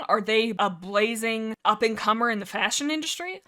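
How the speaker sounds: usual speaking voice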